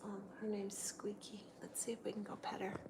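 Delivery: hushed whisper to the viewers. Real, not acted. Owner sneaking up on her cat, whispering. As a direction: whisper so the cat isn't startled.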